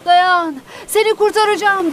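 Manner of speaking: restrained rescuer's shout; narrated shout. A narrator's reading. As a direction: call out to rescue someone, without full volume. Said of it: "diye bağırdım".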